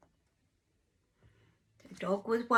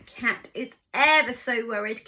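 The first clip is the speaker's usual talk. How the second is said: ordinary delivery, nothing marked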